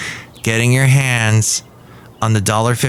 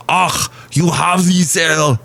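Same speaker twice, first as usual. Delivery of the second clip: thick, hammed-up German accent, higher and slow; Arnold-style German accent; 7 semitones higher, slower than usual. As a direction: overdo the German accent, drawing out the vowels. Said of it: "Now I'm sounding like Arnold".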